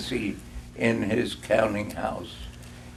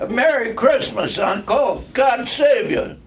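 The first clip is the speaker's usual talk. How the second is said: high, loud and bright with a laugh; young nephew bursting in with a laughing greeting; cheerful cry; 10 semitones higher, 5 dB louder than usual. A narrator's reading. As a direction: call it out full of holiday cheer. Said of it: "cried a cheerful voice".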